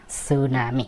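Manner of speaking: "sunami" said softly, no T; soft demonstration of "sunami" without T; "sunami" with silent T. Shown as a demonstration. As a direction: start "tsunami" on S, quietly. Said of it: "টি এর উচ্চারণটা ওরা করে না".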